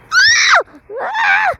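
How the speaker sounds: high piercing scream of panic. Real, not acted. Player screaming at the tornado in the game, high panicked shriek. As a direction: shriek as the tornado grabs you.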